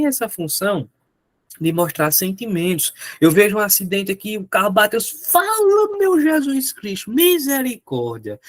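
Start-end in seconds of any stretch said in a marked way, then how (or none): 5.3-8.4 high, slow shocked cry to Jesus; shocked exclamation. Acted. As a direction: react to a car crash in alarm. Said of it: "Eu tô mostrando minha emoção". (espanto exagerado diante de um acidente)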